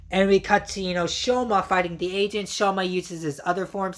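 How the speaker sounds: ordinary talk, nothing marked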